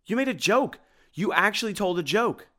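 very high, loud amazement; flabbergasted. A narrator's reading. Tim can't believe Simon told a joke, flabbergasted. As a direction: squeal in utter disbelief.

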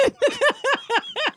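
high squealing laugh; real laugh, high and bright; burst of laughter. Real, not acted. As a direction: crack up at your own bit. Laughs after the impression.

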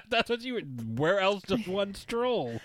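high giggly laughing words. Real, not acted. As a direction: laugh through it. Laughing during song bit.

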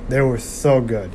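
ordinary talk, nothing marked

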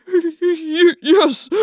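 stuttering frightened yes; terrified stammer. Acted. Trainer stammers in terror.

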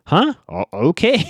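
high, rising mock-baffled okay; puzzled surprise. Recapper voicing a girl just handed a phone number, puzzled. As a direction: let the okay climb way up in comic puzzlement.

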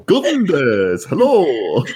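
high, drawn-out singsong call. Pretend. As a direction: call out playfully for someone, stretched long. Calling out for someone at home.

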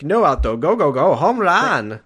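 high, loud hammed-up catchphrase; mock cheering yell. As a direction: cheer it out in a big silly voice. Answers with own shouted sign-off catchphrase.

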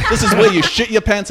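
loud, high schoolyard jeer; hammed-up teenage bully taunt; mocking taunt.

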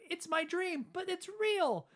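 high squeaky mock kid voice; kid explaining. Max telling his dad the dream is real, mock kid voice. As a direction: play it up like a wide-eyed kid. Retelling Max's line.

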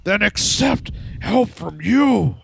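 high, slow, strained villain voice; hammed-up villain line in a high put-on voice; Little Nicky voice. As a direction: play the Star Trek villain as Little Nicky. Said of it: "why do you sound like Little Nicky when you do that".

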